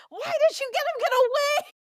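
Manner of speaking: very high-pitched mock wail of disbelief; exasperated outcry. Podcaster recalling her reaction to the villain escaping, exasperated high outcry. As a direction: replay a viewer's high, squealed protest.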